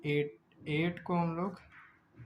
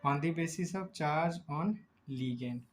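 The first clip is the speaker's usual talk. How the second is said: slow dictation; much slower than usual. Shown as a demonstration. Teacher dictating the heading again.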